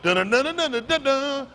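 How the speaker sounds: vocal guitar riff; hummed riff. Retold or imitated. Teacher humming the song's guitar riff to the class. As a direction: hum the riff in rhythm.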